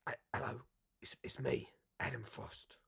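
whispered put-on voice; breathy whispered mock Adam Frost; Adam Frost impression. Introducing a blurb to viewers, whispering as Adam Frost. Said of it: "Weren't a good impression".

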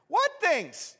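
high, playful innocent asking; Jesus feigning ignorance, light and high; innocent question. Acted. Jesus plays dumb with the grieving travelers, asking high and light. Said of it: "Jesus asked".

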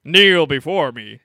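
booming, haughty royal decree; pompous king voice. Retold or imitated. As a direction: bellow like an offended king. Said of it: "Like that".

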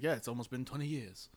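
a hint of an Obi-Wan voice, quiet and level; Ewan McGregor. Host quoting the actor on filming dates, soft light impression. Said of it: "my best Obi-Wan Kenobi voice".

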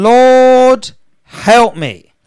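crying out. Acted. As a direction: plead at the top of the voice. Building to woman's cry.

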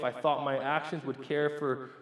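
normal talking voice

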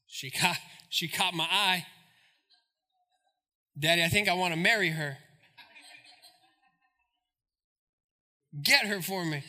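low, soft pleading son voice; a spoiled son begging his daddy; whiny son. Retold or imitated. Samson begging his parents, a low soft pleading son. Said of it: "he tells his father and mother".

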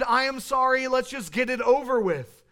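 ordinary talk, nothing marked

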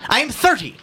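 high-pitched haughty correction; condescending. A narrator's reading. Son answering the policeman about his age, condescending. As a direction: say it loftily, pitched well up. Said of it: "Ignatius said condescendingly".

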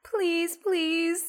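high, soft mock begging; mock pleading. Guest hosts begging to join, high mock plea. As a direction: plead like a hopeful fan.

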